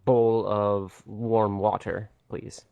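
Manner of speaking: slow, careful asking; slow polite request. Acted. Adventurer slowly asking the barkeep for warm water. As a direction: draw the request out gently. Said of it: "I will say".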